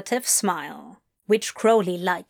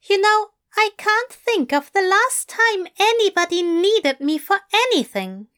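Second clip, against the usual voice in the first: higher, plain and unsentimental; matter-of-fact Aziraphale; 11 semitones higher, 4 dB louder than usual. Acted. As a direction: state it simply, no self-pity. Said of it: "said Aziraphale matter-of-factly".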